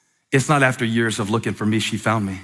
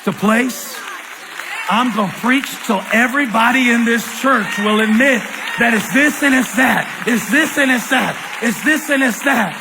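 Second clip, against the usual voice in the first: full-force preaching; 11 semitones higher than usual. Acted. Preacher roaring at the whole church. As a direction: preach it out to the rafters.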